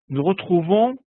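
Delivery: ordinary delivery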